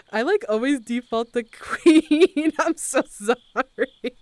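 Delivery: loud, laughing through it; laughing apology. Real, not acted. Streamer apologizing for defaulting to queen, real laughing. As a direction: crack up while apologizing, words stretched.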